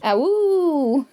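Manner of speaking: playful laughing wolf howl. Pretend. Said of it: "That wasn't how it sounded a minute ago".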